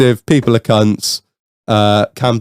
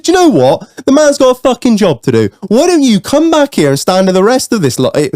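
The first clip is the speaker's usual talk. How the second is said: high-pitched indignant rant, retold; worked-up scolding, played for laughs; acted-out angry tirade; 10 semitones higher than usual. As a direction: re-enact laying into someone in a queue. Retold row, his tirade.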